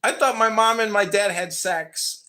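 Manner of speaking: usual speaking voice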